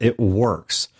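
ordinary talk

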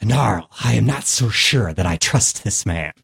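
lightly airy voice from the roof of the mouth; airy guttural Knoll. Shown as a demonstration. Player demonstrates his other Knoll, lightly airy creature voice. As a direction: keep a hint of guttural under an airy tone. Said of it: "more of an airy voice".

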